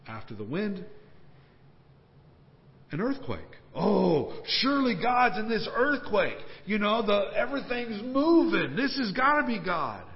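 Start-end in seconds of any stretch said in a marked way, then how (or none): 3.7-10.0 excited hope. Acted. Preacher voicing Elijah's excited hopes.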